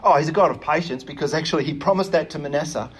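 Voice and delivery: usual speaking voice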